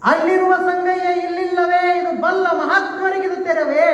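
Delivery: high, keening plea; anguished cry. Acted. Neelamma cries to Sangayya in a high keening wail. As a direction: lift the voice into a desperate wail.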